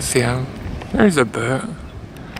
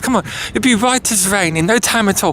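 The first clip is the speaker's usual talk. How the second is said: chatty mock-British voice; put-on British accent; 2 semitones higher than usual. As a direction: coax in a playful British accent. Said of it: "hear you talk like that".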